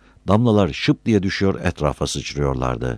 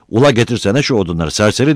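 raised, loud bossy yell; shouted order; 6 semitones higher, 5 dB louder, faster than usual. A narrator's reading. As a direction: shout at a lazy helper. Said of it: "diye bağırdı Hüsnü".

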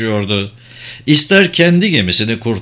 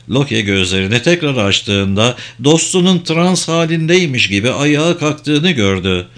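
plain reading voice

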